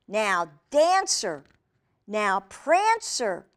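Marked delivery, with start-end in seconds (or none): none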